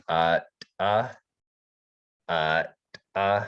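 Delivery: popped glottalized T syllables "uh"; glottal T. Shown as a demonstration. Teacher showing the glottal T syllables, clipped. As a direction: snap each "uh" off with a glottal T. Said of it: "And then the T would be".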